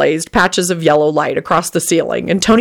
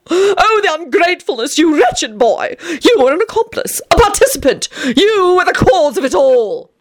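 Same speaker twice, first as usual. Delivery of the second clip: indignant vampire; 11 semitones higher than usual. A narrator's reading. Tony scolding Teddy.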